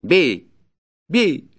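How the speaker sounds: high, loud fierce dare; explosive, furious one-word cries; ferocious voice. A narrator's reading. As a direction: savagely dare him to strike. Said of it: "подхватил мужик свирепым голосом".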